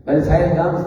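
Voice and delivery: normal talking voice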